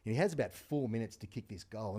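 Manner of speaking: ordinary talk, nothing marked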